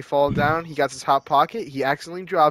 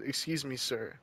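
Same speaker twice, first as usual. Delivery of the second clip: much softer, politely asking a stranger; polite soft approach; 14 dB quieter than usual. Pretend. Podcast host voicing a polite ask to a factory worker, soft. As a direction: ease up to him gently and politely. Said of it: "and said".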